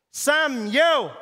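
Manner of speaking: high, loud yell of a name. Retold or imitated. Guard yelling Samuel's name across the camp. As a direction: call him out sharply, far off.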